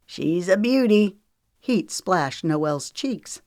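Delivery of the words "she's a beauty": proud. A narrator's reading. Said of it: "Knox said proudly".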